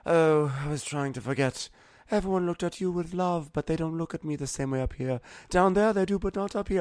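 high, put-on whiny queen voice; mock sad queen. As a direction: whine it like a sulking queen. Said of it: "she's basically all sad because she's like".

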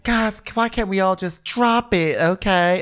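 very high, slow, whiny plea; pleading peacemaker. Retold or imitated. Grace begs everyone to drop it, pleading. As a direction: plead in a high, drawn-out put-on voice.

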